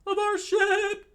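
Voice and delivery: loud, very high falsetto shriek; high cartoon cry of dismay; shrieking crew voice. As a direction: squeal it out slowly and loudly. Acted horrified crew in the story.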